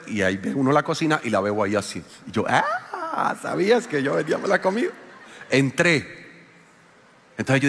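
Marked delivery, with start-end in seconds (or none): 2.5-4.9 a teasing mock jab at his wife; sarcastic aside. Mock greeting on seeing wife.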